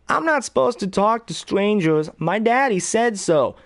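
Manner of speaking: put-on high little-boy voice; high childlike voice, slowing at the end; Georgie. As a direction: answer like a cautious small child. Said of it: "Georgie would go".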